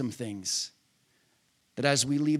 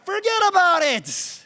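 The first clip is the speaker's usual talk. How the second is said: much higher, louder, exultant cry; triumphant shout; 14 semitones higher, 7 dB louder than usual. Real, not acted. Preacher celebrating the verse with an exultant shout. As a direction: fling it out in triumph.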